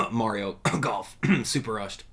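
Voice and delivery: words slipped between pointed fake coughs; fake coughing. Streamer naming a game they hate, pointed fake coughs. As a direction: cough out the title in pieces.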